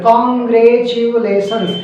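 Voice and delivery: Congratulations read very slowly, syllable by syllable; broken into syllables. Shown as a demonstration. Teacher shows pupils how to read Congratulations slowly. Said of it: "मतलब syllabus में तोड़ देते हैं".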